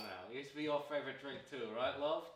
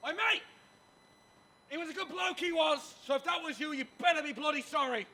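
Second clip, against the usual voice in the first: high, loud accusing shout; angry shouting; 13 semitones higher, 8 dB louder than usual. Acted. Man demanding a stranger be sorry, shouting angrily. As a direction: shout a high, outraged warning.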